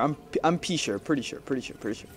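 dwindling, half-voiced self-reassurance; fading mumble. Real, not acted.

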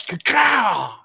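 high-pitched vocal gunshot; imitated gunshot. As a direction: mimic a rifle going off, drawn out. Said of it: "all we heard was".

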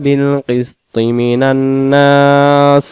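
slow melodic tajweed chant; slow chanted Quranic recitation. Shown as a demonstration. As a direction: draw each vowel out in recitation.

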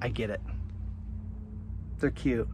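ordinary talk, nothing marked

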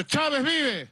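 high mock rallying cry; imitated shout. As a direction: mimic a lad calling out a slogan. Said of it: "la gente me grita".

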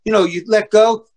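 ordinary delivery

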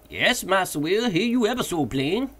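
high dialect voice calling down; Jupiter's voice. A narrator's reading. Jupiter answering.

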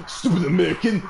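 sneering movie line, lightly voiced; movie villain. Shown as a demonstration. Gamer replaying a kill as a movie, hammed-up sneer. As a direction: spit it like a film baddie insulting a soldier. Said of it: "here's how it would have sounded like if it was a movie".

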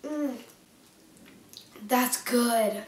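speaking between bites; chewing while talking. Real, not acted. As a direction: mouth partly full. Seen 0: Close-up shows closed, working mouth after bite; biscuit in hands.

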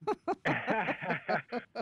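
bright high laugh. Real, not acted. Laughing at cat story.

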